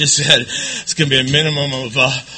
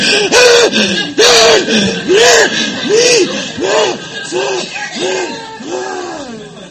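gasping laugh; 5 dB louder than usual. Retold or imitated. Bag over head, gasping.

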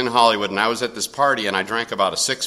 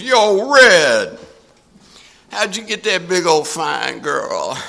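imitating Big Brown; 7 semitones higher, 5 dB louder, much slower than usual. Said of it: "he looked at me and goes".

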